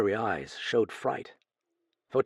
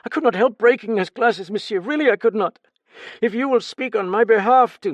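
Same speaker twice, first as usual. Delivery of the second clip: much higher, louder pleading; anxious protest; 12 semitones higher, 10 dB louder than usual. Acted. Steward protesting to the detective, anxious. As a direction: plead that it was not your fault. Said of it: "he protested".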